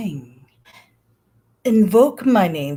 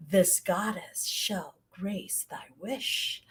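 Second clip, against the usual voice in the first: ordinary talk, nothing marked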